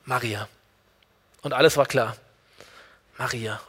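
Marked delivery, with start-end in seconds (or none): 0.0-0.5 tender call. Retold or imitated. Jesus calling Maria by name, much softer. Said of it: "da muss Gott nur eins sprechen".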